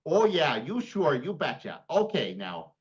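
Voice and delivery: hammed-up Minnesota accent; playful mock-Minnesotan delivery. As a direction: lay on a folksy Minnesota accent. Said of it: "It's the Minnesota accent".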